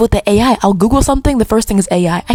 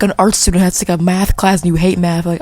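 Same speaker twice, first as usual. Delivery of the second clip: ordinary delivery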